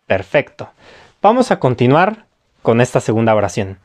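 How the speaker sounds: ordinary talk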